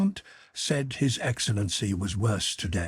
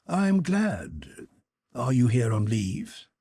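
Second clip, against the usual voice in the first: indifferent tone; 3 semitones higher, slower than usual. Acted. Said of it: "in his usual tone of indifference".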